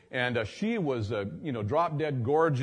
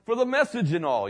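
much higher, bubbly retold voice; eager man; 10 semitones higher, 4 dB louder than usual. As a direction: gush like an excited fan. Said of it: "he says".

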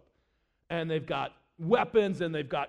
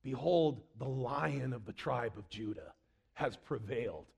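low, soft voice of a comforting angel; hushed reassurance; 8 semitones lower, 8 dB quieter than usual. Acted. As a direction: lower and soften it, quietly solemn. Angel consoles weeping John.

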